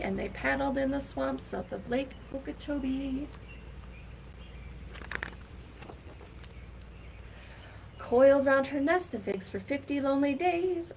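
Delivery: lively, sing-song picture-book reading; reading to children. Acted. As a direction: read it out like storytime for a class.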